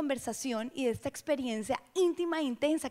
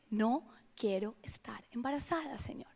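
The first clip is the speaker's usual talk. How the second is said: hushed whispered confession; softly whispered plea; 3 semitones lower, 10 dB quieter than usual. Retold or imitated. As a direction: say it under your breath to God. Quiet confession to God.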